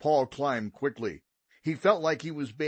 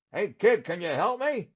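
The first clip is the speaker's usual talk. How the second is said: called down; 7 semitones higher, 3 dB louder than usual. A narrator's reading. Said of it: "a voice called down".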